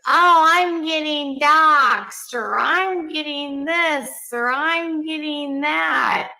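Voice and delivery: stretched out slowly like a slowed clip; slowed-down quote; slowed-down playback. Shown as a demonstration. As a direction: drag each word out slowly. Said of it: "I know you thought that was torture".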